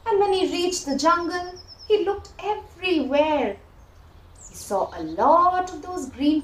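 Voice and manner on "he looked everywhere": ordinary delivery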